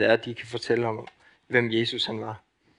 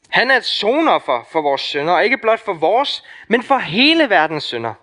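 preaching loud; 7 semitones higher, 6 dB louder than usual. Real, not acted. Preacher builds to climax.

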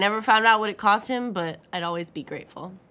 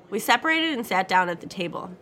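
plain reading voice

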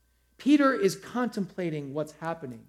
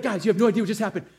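fast, laughing, out of breath; breathless rush; 2 semitones higher, 4 dB louder, much faster than usual. Retold or imitated. Women bursting back to disciples, rushed breathless telling. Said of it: "they're out of breath".

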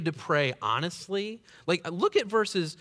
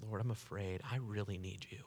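much softer, lower admission of fear; hushed confession; 6 semitones lower, 10 dB quieter than usual. Acted. David quietly confessing his fear to the Lord. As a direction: let it fall to a fearful murmur.

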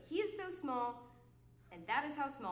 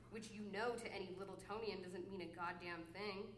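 ordinary talk, nothing marked

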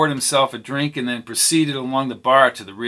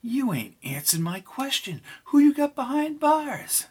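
whispered question; 13 semitones higher than usual. Acted. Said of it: "She whispered".

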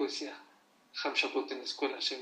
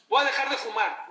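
mock resolution; 9 semitones higher, 10 dB louder than usual. Mocking a smoker's empty promise.

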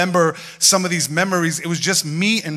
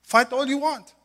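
mom's voice; 9 semitones higher, slower than usual. Retold or imitated. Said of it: "She goes".

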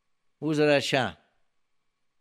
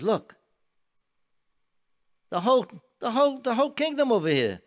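high, taunting mock voice; 9 semitones higher, 3 dB louder than usual. King Ahab taunting Eliyahu Navi, high mocking voice. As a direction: sneer it up high, quick. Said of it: "in a mocking way".

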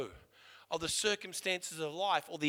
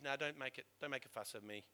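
resigned mumble; 6 semitones lower, 9 dB quieter than usual. Retold or imitated.

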